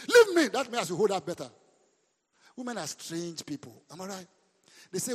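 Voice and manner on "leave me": high, loud burst; a hammed-up shouted order; angry wife. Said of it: "when she said".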